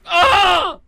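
high loud tennis serve cry; grito del saque. Shown as a demonstration. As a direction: let out a full-voiced server's scream. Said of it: "el grito del saque". (grito agudo de saque)

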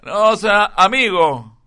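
loud, put-on buddy voice; tipo infiltrado imitado. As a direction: play the over-age guy, loudly. Older infiltrator answers, acted. (voz impostada de colega, fuerte)

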